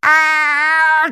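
high, loud startled yelp; frightened giant cry. A narrator's reading. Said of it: "cried the giant".

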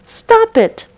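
high, loud shout. Acted. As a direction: yell it out in pain. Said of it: "shouted Big Moose".

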